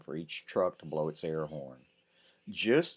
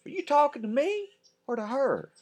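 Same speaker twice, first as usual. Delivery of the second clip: high, pointed comeback; playful put-down; sassy retort; 5 dB louder than usual. Acted. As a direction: toss it back with sass.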